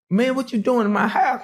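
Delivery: high, outraged what are you doing; a shocked owner confronting a stranger; high, startled indignation; indignant surprise. Retold or imitated. Homeowner confronts intruder.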